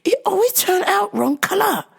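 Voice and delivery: high, broken-English imitation of the old mom. Old mom complaining to the clerk her hair dye never works, high mimicked voice. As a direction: mimic the mom asking for help. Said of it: "she said".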